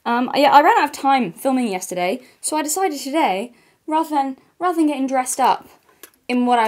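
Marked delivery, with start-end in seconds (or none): none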